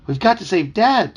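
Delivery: put-on high, loud plea from a boy; urgent cry. A narrator's reading. Boy pleads with his dog to save dad, urgent cry. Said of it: "Toby cried".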